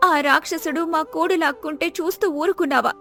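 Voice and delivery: grieving poor wife. Acted. Wife pleading with God over her suffering husband, grieving. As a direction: lament to God on a high, sustained pitch.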